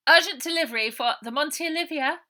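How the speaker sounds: high, loud and pressing; loud urgent call. Acted. Jin bluffs the gate man.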